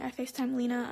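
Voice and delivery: usual speaking voice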